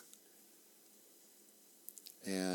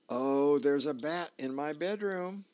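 put-on teen son's voice; 8 semitones higher, 4 dB louder than usual. Teen son's note read aloud.

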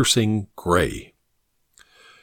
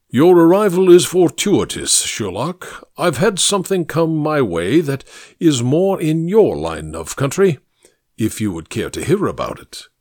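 grand, self-important address; pompous senator oratory; 5 semitones higher than usual. Acted. Mycroft voiced.

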